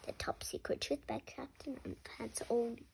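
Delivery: secret hushed whisper. Acted. Child reader whispering the secret part. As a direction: breathe it like a big secret.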